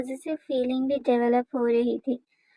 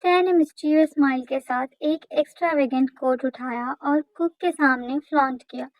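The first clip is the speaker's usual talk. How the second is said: ordinary delivery, nothing marked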